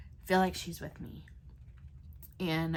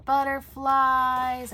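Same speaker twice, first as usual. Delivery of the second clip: "butterflies" stretched out slow, higher and louder; delighted; 5 semitones higher, 9 dB louder, much slower than usual. Real, not acted.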